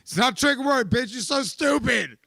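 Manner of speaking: high, loud, insulting snap; scornful outburst. Retold or imitated. Man replaying insulting his girlfriend over trigger words. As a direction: sneer at her loudly. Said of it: "she's like".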